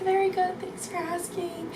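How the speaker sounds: soft, high, fading reply. Retold or imitated. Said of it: "she has such a soft voice".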